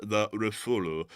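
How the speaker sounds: Rafale said slowly as ruh-FOO-luh, French-style; French-style pronunciation. Shown as a demonstration. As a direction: draw out Rafale with a French-style R and soft final syllable. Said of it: "My pronunciation of the French".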